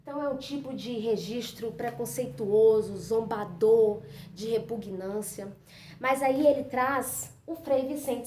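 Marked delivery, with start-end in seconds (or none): none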